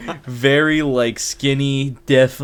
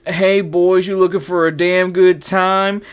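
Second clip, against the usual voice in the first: higher, louder flirty hustler's pitch; lightly voiced sleazy come-on; streetwalker come-on; 6 semitones higher, 4 dB louder, slower than usual. A streetwalker sidling up to two students, lightly flirty. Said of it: "and she's like".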